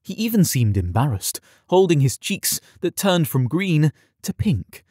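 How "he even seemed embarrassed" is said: plain reading voice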